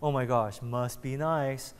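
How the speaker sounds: low and soft, slowing on must be nice; quietly covet the car under your breath; envious self-talk. Retold or imitated. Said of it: "you're talking to yourself".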